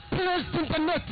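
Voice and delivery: high, fast-paced live goal commentary; excited play-by-play. Acted. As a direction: call the goal to the crowd with rising excitement.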